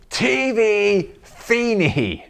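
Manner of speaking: very slow, high, drawn-out "T V Feeney" with a falling end; stern demand. Retold or imitated. Angry father ordering French stranger to stop the TV, slow drawn-out. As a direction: lay out each syllable slowly like a command to a foreigner.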